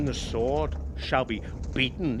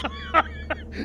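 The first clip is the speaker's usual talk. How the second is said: burst of laughter. Acted. Old man amused by brother's remark.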